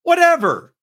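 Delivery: high, drawn-out sarcastic whatever; mock dismissive whatever. Mocking the offended crowd with a high, dismissive whatever. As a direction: toss off a snooty, dismissive whatever.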